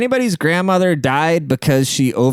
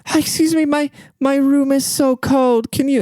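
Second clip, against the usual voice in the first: put-on British accent. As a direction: plead in a very high British voice. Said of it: "she had a British accent".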